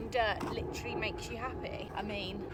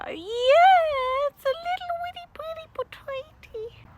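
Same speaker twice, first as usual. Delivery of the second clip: cutesy voice; 12 dB louder, slower than usual. Pretend. Cooing over a small potato.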